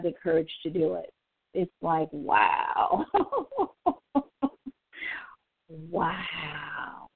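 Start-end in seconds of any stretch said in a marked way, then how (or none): 3.1-4.7 high, disbelieving laugh; real bitter laugh of disbelief; incredulous laugh. Real, not acted.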